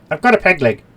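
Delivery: high, loud put-on voice; hammed-up character voice. Traumatised character protesting, hammed-up high voice. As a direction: pipe it up as a comic complaint. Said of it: "And so he says".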